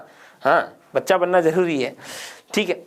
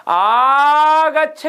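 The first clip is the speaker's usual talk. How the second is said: higher, louder, slow drawn-out call; calling from afar; 7 semitones higher, 6 dB louder, much slower than usual. Shown as a demonstration. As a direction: call out to Krishna far off in the field. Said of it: "दूर खड़ा है ... बुलाना है". (दूर खड़े कृष्ण को लंबी पुकार देकर बुलाना)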